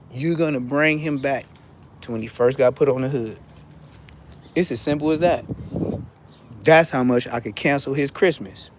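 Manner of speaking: ordinary talk, nothing marked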